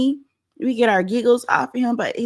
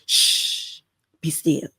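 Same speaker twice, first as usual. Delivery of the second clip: hushed shushing; 4 semitones lower, much slower than usual. Pretend. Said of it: "got him in a headlock".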